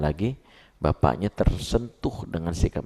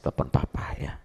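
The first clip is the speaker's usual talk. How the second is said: soft whisper of a private instruction; 3 semitones lower, 5 dB quieter than usual. Retold or imitated. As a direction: whisper it like a secret to your wife. Father tells wife to call him. (berbisik pelan)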